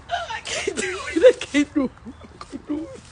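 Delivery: high sobbing wail, fading away; helpless, high-pitched sobbing; crying. Real, not acted. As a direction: give up in real tears, voice high. Breaks down facing the bird.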